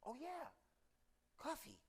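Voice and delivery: small, high, soft voice; hushed realization. Acted. Customer spotting his waiting cup, in a tiny high voice. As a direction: murmur it as if just noticing.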